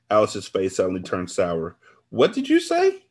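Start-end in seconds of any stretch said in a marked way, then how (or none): 2.1-3.0 high-pitched, loud incredulous demand; shocked disbelief. A narrator's reading. Friend questions what she just heard, shrilly incredulous.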